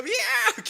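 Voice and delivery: very high mock cat screech; sudden high animal cry through laughter; imitated cat yowl. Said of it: "They go".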